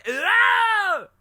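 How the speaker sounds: very high British shriek; hammed-up, ear-splitting British yell; mock British accent. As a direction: scream it in a silly British voice. Mock British exchange, shouting.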